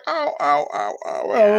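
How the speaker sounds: high-pitched imitated ow-ow-ow; repeated cartoonish cries of pain; mock pained yelps. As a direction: yelp as if being bitten, played for comedy. Acting out being bitten on the skull.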